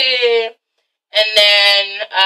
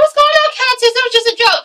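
high, breezy imitation; mock Carmen; 10 semitones higher, faster than usual. Vlogger imitating Carmen greeting the cold ladies, high and breezy. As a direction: chirp it up high, playing innocent. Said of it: "Carmen's like".

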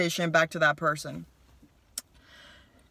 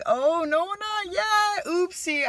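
sudden much higher, sheepish sing-song apology; embarrassed squeak; 13 semitones higher, 3 dB louder, slower than usual. Retold or imitated. Caught messaging the head of school, high embarrassed squeak. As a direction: squeak it out like a kid caught red-handed.

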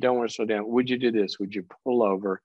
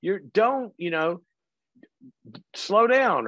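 urgent calling out; 7 semitones higher than usual. Retold or imitated. Dad alarmed at daughter's driving, calls out urgently. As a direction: warn the new driver to slow. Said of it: "I said".

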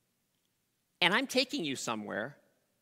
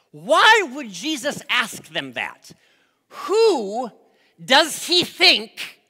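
indignant; 5 semitones higher, 9 dB louder than usual. Acted. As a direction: challenge him in mock outrage. Indignant preacher questioning Jesus.

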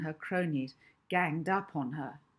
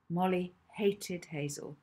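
ordinary delivery

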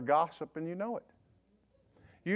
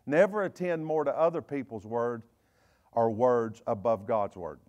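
normal talking voice

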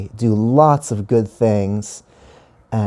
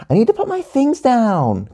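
put-on high-pitched kid calling out; high, sustained call; 13 semitones higher than usual. A narrator's reading. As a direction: call across the room in a high voice. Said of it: "called Gia".

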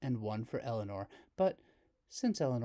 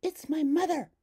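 a lightly voiced girl, higher and louder; proud girl naming her mother; higher, louder child; Hazel; 8 dB louder than usual. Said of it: "said Hazel".

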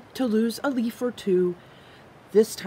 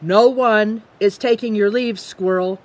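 ordinary delivery

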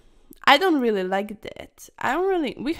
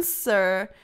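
ordinary talk, nothing marked

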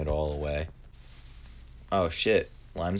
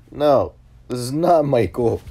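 loud, high and drawn out; hammed-up insistence, raised and slow; exasperated retelling; 7 semitones higher, 10 dB louder, slower than usual. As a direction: loudly set a wrong caller straight. Retells reply to wrong number.

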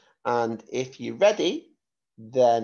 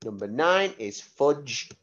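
ordinary talk, nothing marked

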